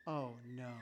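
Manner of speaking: soft, low, sympathetic oh no; gentle oh no. Shown as a demonstration. Pastor showing parents a soft sympathetic oh no. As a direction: sigh it out with sorrow for the child. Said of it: "by saying two words".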